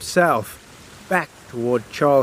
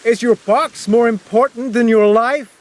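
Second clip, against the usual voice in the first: high, raised pleading question; shouting; 8 semitones higher, 3 dB louder than usual. Acted. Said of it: "I shouted".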